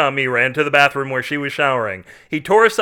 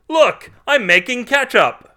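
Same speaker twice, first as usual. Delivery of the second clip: high-pitched, loud showing off; very high, over-excited exclamation; excited yell; 10 semitones higher, 4 dB louder, slower than usual. A narrator's reading. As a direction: cry out in manic pride. Carl excitedly shows off ketchup.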